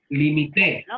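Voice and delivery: slow "limité", said li-mi-TÉ; stress on the last syllable. Shown as a demonstration. Teacher setting the class a word, slow, "limité" stressed on TÉ. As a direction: draw it out, landing on TÉ in "limité". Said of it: "Limité, lleva acento en la E".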